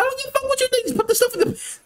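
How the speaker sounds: high-pitched mock fury; exasperated outburst. Customer fed up with a store clerk, exasperated. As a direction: act out losing patience, voice shooting way up.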